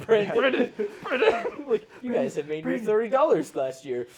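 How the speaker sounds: high squealing laugh through the words; laughing mock plea. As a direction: laugh while pleading up high.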